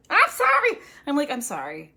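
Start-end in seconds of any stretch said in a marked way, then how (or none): 0.1-0.8 very high, loud squeaked apology; apologetic patient. Acted. Scolded patient pleading with the doctor, high mock wail. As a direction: imitate a patient wailing sorry.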